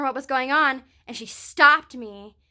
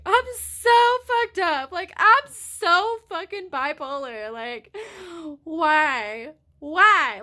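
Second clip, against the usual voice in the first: distressed self-reproach; 6 semitones higher, 4 dB louder, much slower than usual. Retold or imitated. Retold self-reproach after crying.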